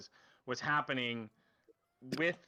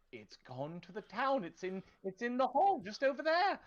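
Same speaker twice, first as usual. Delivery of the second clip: higher, hesitant official's voice; nervous town official; 9 semitones higher than usual. Acted. Cornered town official stammers excuses to the knife-wielding player, high and nervous. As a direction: fumble excuses like a cornered bureaucrat.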